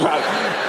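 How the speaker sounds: stifled snort of laughter in church; a suppressed giggle bursting out. Retold or imitated. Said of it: "Bob sort of goes".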